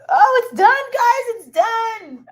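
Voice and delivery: high, loud excited announcement; thrilled to tell the viewers. Real, not acted.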